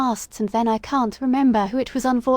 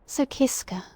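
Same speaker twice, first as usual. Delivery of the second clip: whisper; 4 semitones higher than usual. Real, not acted. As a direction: breathe it out, no voice. Sudden voiceless aside.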